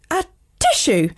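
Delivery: high-pitched mock sneeze; a sudden storybook sneeze; acted sneeze. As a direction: sneeze it out as a spoken "atishoo". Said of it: "everyone in the classroom was sneezing".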